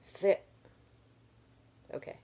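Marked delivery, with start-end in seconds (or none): 0.0-0.4 a sharp, louder spat-out "Fit"; spitting sound. Shown as a demonstration. Reader voicing the almond being spat onto cobblestones, sharp. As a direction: spit the almond out with a loud high "Fit". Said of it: "making sure this was, like, a sound".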